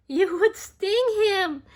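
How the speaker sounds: spring the answer on the listeners; high, loud playful punchline; excited answer. Acted. Asks children what bee would do.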